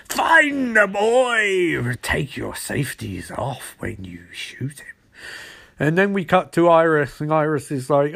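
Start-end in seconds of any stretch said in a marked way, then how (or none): 0.1-4.9 very slow villain command, falling low; Trickster. Retold or imitated. Villain ordering his pursuers, hammy and menacing. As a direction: stretch the order grandly, then sink low. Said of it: "he's still like".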